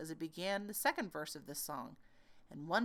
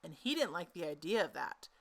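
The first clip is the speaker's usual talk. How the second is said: ordinary delivery, nothing marked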